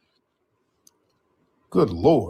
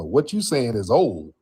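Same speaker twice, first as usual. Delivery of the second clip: normal talking voice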